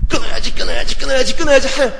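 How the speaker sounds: loud, high repeated vow; mock a man forcing himself to quit; hammed-up frantic self-promise; desperate resolve.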